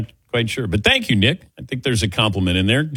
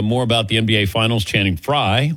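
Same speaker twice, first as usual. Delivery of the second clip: ordinary talk, nothing marked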